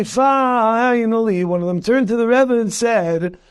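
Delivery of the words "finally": finally stretched slowly, held long; slow the word finally right down to build suspense; drawn-out finally. Shown as a demonstration. Building to the story's punchline, finally said slowly and drawn out.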